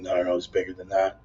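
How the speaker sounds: usual speaking voice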